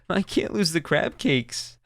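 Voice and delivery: put-on sobbing child. Sobbing little girl pleading over her cooler, starting to cry. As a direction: let the little girl's voice crumple as she begins crying. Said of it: "She just started to sob".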